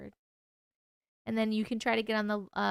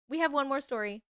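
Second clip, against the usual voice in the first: ordinary delivery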